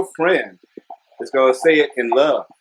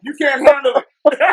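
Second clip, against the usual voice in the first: laughing; 13 semitones higher than usual. Real, not acted. Laughing while quoting.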